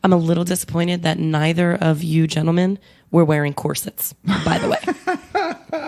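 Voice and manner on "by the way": teasing drawl. Real, not acted. Teasing about corsets.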